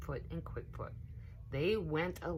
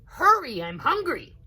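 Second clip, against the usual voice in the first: put-on high, loud childish whine; squeaky little alligator yelling; child's shout; 12 semitones higher, 12 dB louder than usual. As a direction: call out hungry and impatient.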